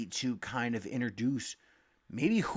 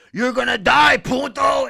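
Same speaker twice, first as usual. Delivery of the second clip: much higher, louder mock shout of a high roommate; retold knife threat yell; 11 semitones higher, 13 dB louder than usual. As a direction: imitate a drugged roommate charging with a knife. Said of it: "and he's like".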